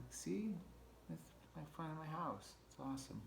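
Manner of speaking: soft, high little critter voice; proud pack rat. Retold or imitated. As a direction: show off lightly as the rat, softly and high.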